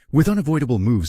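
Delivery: usual speaking voice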